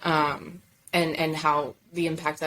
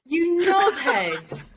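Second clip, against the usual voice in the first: slow, high, loud and laughing knobhead; mock British insult; 12 semitones higher, 6 dB louder, much slower than usual. Guest trying British slang on the hosts, laughing. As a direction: drag it out in a jokey British voice. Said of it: "We have to say knobhead".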